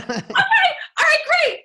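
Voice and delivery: very high, squeaky eager yes; hammed-up giddy squeak; giddy agreement. Actress agreeing to the casting director, giddy. Excited reply to director, retold.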